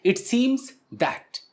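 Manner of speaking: each word stretched out very slowly; teacher drawing out the pattern for students; slow demo. Shown as a demonstration. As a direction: spell the phrase out slowly for learners. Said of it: "यहां पर that चिपका दो".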